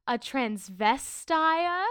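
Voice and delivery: "transvestire" drawn out, ending in -ire; misread word. Acted. Agent reading the list stumbles, drawn-out "transvestire". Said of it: "Is that supposed to say".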